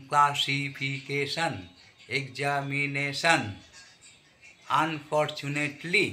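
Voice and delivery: ক্লাসিফিকেশন, এক্সামিনেশন, আনফরচুনেটলি very slowly, every syllable apart; teacher drawing out each separate syllable of ক্লাসিফিকেশন, এক্সামিনেশন, আনফরচুনেটলি. Shown as a demonstration. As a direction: sound out ক্লা-সি-ফি-কে-শন, এক-জা-মি-নে-শন, আন-ফর-চু-নেট-লি. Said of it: "চারটার বেশি syllable থাকে".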